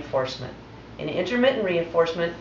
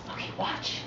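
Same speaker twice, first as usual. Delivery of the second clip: hushed, breathy command; soft whisper to the dog; whispered cue; 11 dB quieter than usual. Real, not acted.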